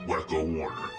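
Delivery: ordinary talk, nothing marked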